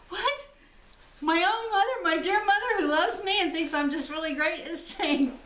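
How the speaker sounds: high-pitched, hammed-up disbelief; comic outraged retelling, pitched well up; mock indignation. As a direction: play up being wounded by your own mother. Acts out her shock at mother.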